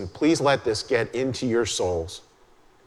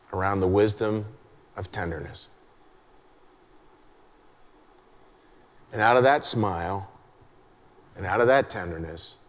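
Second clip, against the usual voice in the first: guided meditation; 5 semitones lower, 4 dB quieter than usual. Acted. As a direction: lead them gently into the picture. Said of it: "this is how I'd ask you to hear it".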